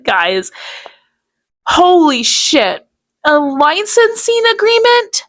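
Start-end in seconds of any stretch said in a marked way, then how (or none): 1.7-5.2 high, incredulous; can't believe what he heard; disbelief. Real, not acted. Incredulous at licensing fee demand.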